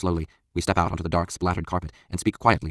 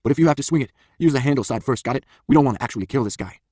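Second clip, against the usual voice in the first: raised, emphatic coaching before a fight; urgent instruction; 6 semitones higher, 4 dB louder than usual. A narrator's reading. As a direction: lay down the rules firmly. Bullet instructs nervous friend before fight.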